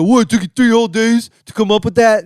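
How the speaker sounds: high, loud sneering jab; mock critic. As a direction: sneer it like a smug troll. Mocking an online critic.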